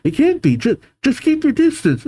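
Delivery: high-pitched panicky warning; alarmed warning. Acted. Giants warning comrades away, high panicked voice. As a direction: warn them off in acted alarm. Giants warn each other off.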